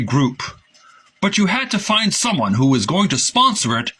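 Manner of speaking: a hint of the designer reminiscing; quoted engineer. Reading Hector's quoted recollection.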